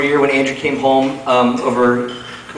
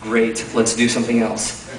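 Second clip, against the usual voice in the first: bored sarcasm; 3 semitones lower, 7 dB quieter than usual. Retold or imitated. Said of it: "I was like".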